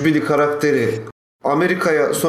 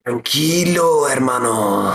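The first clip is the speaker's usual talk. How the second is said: put-on slow, playful Spanish accent with long-held vowels; mock Spanish drawl; 2 semitones lower, slower than usual. Co-host soothing his "hermano", in drawn-out mock Spanish. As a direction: stretch it out like a hammed-up Spanish amigo.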